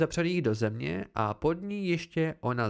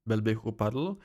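plain reading voice

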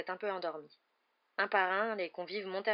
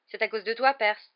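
loud, earnest-sounding tease; mock serious; 4 semitones higher, 10 dB louder than usual. A narrator's reading. Brother teasing the new prefect, deadpan serious. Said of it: "le plus sérieusement du monde".

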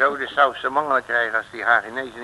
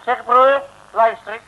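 loud, raised call to a passer-by; 9 semitones higher, 10 dB louder than usual. Acted. Loris hailing a stranger for directions, loud call. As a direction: hail him down the street.

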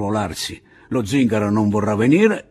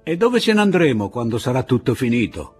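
raised, fast question shouted close to the ear; shouting into his ear; 8 semitones higher, 9 dB louder, faster than usual. Acted. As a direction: shout it right into the deaf man's ear. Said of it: "gridò Pilar all'orecchio di El Sordo". (Pilar grida all'orecchio del sordo, con voce alta e rapida)

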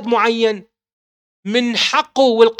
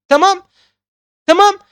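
pointed rhetorical check; 9 semitones higher, 6 dB louder than usual. Real, not acted.

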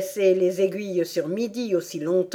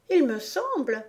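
higher-pitched, mildly disdainful; scornful; 6 semitones higher than usual. A narrator's reading. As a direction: say it with prim contempt. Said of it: "dit-elle avec mépris". (avec mépris)

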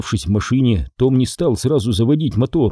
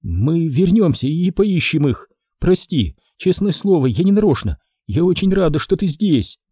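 hiccupping; 7 semitones higher than usual. Acted. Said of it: "вдруг начала икать".

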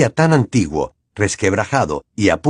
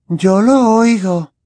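Luna's slow, higher dreamy voice; thin voice; 11 semitones higher, 4 dB louder, much slower than usual. A narrator's reading. Luna telling the others, slow and higher. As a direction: draw it out, faint and far away. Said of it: "dijo Luna con un hilo de voz".

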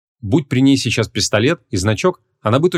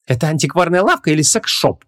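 higher-pitched, squeamish woman; disgusted; 7 semitones higher than usual. A narrator's reading. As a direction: inquire with fastidious distaste. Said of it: "брезгливо осведомилась Ванесса".

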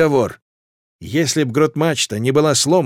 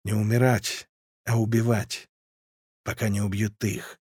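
soft, lower grim repeat; quiet grim echo; 4 semitones lower, 8 dB quieter than usual. A narrator's reading. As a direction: murmur it to yourself, heavy. Narrator echoes captain's words quietly.

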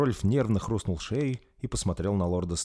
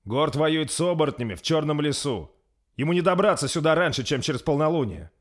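higher, louder dismissive retort; 8 semitones higher, 5 dB louder than usual. A narrator's reading. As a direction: brush it off. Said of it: "Отвернувшись, проговорил Рольф". (Рольф пренебрежительно отвечает, отвернувшись)

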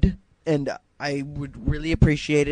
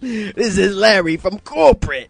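mock British accent; 6 semitones higher, 3 dB louder than usual. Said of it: "when I do the British accent".

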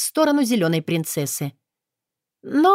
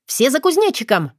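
shouting; 6 semitones higher, 3 dB louder than usual. Acted. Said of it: "хором выкрикнули".

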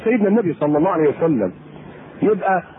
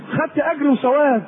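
ordinary talk

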